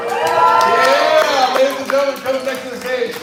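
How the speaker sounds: loud, high-pitched crowd-rousing shout; hype announcer. Real, not acted. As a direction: whip up the crowd for the next act. Host hyping the next act.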